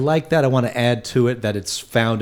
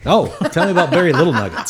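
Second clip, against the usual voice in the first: mock eager; 5 semitones higher, 3 dB louder, faster than usual. Real, not acted. Mock eager prompt, laughing.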